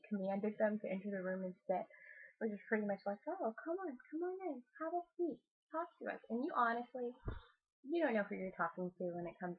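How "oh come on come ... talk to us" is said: high, soft sing-song welcome; light, higher-pitched imitation of inviting someone in; mock friendly invitation. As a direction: coax them in sweetly, imitating a casual host. Voicing investigators inviting spirits in.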